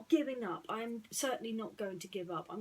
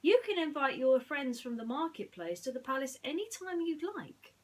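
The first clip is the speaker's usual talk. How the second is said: high, warm-hearted offer; Aladdin, generous; 7 semitones higher, 7 dB louder than usual. Acted. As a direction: make the offer sound like a gift. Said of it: "he said".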